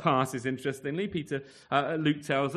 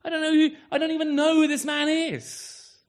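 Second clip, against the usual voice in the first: high and loud, a cursing outburst; swearing denial; 12 semitones higher, 7 dB louder than usual. Acted. Peter cursing at the crowd, high loud denial. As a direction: swear you don't know him. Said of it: "he's cursing, he's swearing".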